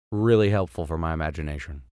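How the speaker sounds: plain reading voice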